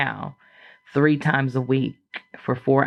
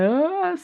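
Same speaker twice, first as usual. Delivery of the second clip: mock sob. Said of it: "So I'm crying".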